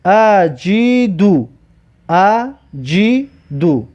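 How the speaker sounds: اجیدو stretched slowly as /adʒidu/; slow Arabic reading. Shown as a demonstration. Teacher drilling pupils on a Quran word, slow recitation. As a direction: spell out اجیدو syllable by syllable. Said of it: "و اینجا".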